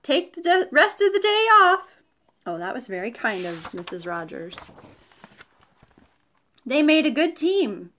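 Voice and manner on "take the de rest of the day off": high, loud friendly call across the yard; a cheery shout goodbye. A narrator's reading. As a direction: call it out to someone walking away. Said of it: "Missus Rogers waved and called out".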